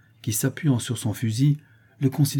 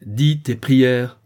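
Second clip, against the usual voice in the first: slow, loud, fearsome command; terrible voice; 3 semitones higher, 7 dB louder, much slower than usual. A narrator's reading. Father repeating the order to his begging son, terrible. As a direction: thunder it out slowly, word by word. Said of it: "d'une voix terrible".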